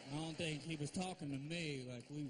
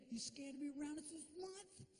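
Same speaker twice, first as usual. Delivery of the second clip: mock taunt, higher and softer; taunting son; 7 semitones higher, 6 dB quieter than usual. Son on the phone taunting dad. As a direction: tease it like a kid calling dad out.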